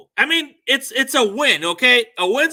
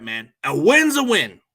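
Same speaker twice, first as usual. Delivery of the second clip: normal talking voice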